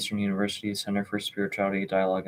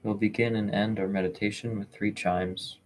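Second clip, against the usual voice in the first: calm, measured meditation voice with long pauses; unhurried, even delivery, leaving space between phrases; guided meditation. Real, not acted. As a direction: gently guide listeners into stillness, phrase by phrase.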